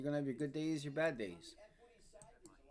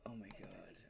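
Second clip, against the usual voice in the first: dismayed whisper; 6 semitones lower, 15 dB quieter than usual. Real, not acted. Dismayed at missed trade.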